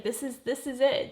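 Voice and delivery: normal talking voice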